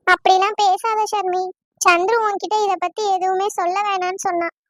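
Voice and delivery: ordinary talk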